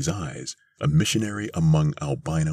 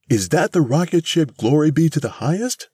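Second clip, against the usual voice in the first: high, loud disbelief; appalled cry; 12 semitones higher, 5 dB louder than usual. A narrator's reading. Missus Bellows sees the battered rocket, appalled. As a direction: cry out the question, pitched far up. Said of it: "cried Missus Bellows, appalled".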